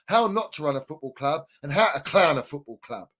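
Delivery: usual speaking voice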